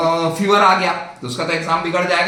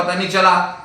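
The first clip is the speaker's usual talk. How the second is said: ordinary delivery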